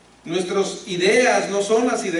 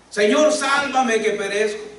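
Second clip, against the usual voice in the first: slow, louder, higher desperate cry; cry for help; 4 semitones higher, 4 dB louder than usual. Retold or imitated. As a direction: cry out as if sinking. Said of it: "empezó a gritar". (Pedro se hunde y grita pidiendo ayuda al Señor)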